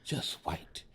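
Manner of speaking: soft and low, fading to almost nothing; hushed setup. Real, not acted. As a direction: let the words sink away for effect.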